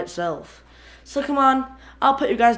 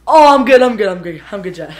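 laughing; 12 dB louder than usual. Real, not acted. Recovering, laughing.

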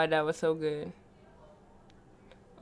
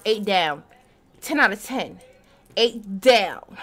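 ordinary talk

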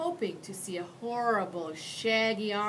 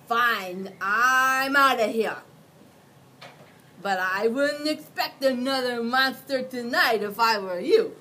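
huffy monster; 4 semitones higher, 11 dB louder than usual. Acted. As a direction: storm off with a parting threat. Mac leaves in a huff.

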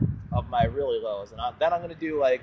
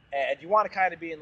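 usual speaking voice

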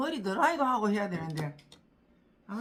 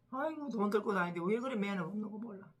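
ordinary delivery

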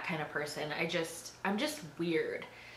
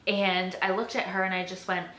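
usual speaking voice

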